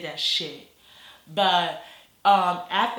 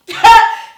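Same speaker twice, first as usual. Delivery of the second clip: sudden loud, high burst of laughter; real high cackle bursting out; laughing; 22 dB louder than usual. Real, not acted. Storyteller cracking up at her own tale, loud laugh.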